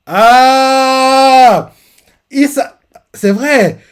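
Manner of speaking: high, loud cry of realization; a real gasp of surprise, then slow; sudden realization. Real, not acted. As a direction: it just hit you, blurt it out. Sudden realization mid-recap.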